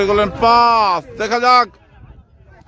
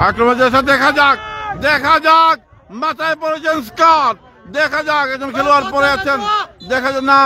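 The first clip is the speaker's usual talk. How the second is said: lively play-by-play, rising a bit; football commentary; 3 semitones higher than usual. Real, not acted. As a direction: build the tension of the attack.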